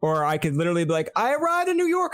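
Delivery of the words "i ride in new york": high mock boast. Imagined boast on street.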